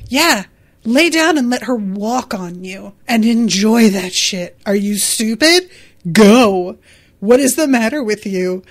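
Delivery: loud, emphatic mock telling-off; punchy pretend scolding, loud on GO; mock exasperated scolding. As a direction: imitate a friend berating an idiot. Paraphrasing Errol berating his friend.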